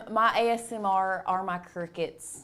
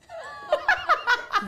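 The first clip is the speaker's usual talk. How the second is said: real high-pitched laughter. Real, not acted. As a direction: burst of high, helpless laughing. Alexa starts playing crickets by surprise.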